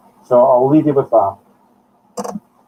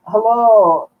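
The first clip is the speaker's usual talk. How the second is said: breathy spirit voice; 7 semitones higher, 5 dB louder than usual. Shown as a demonstration. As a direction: sound like someone short of breath. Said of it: "I want to sound like this".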